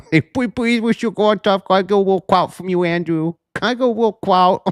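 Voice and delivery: high, whiny pleading voice; baby-voiced begging. Mocking Brian begging Andrew for clout, high whiny voice. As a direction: beg like a needy kid.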